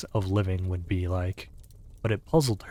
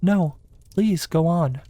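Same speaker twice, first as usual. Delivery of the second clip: humble; 10 semitones higher, 6 dB louder than usual. A narrator's reading. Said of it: "said Alice very humbly".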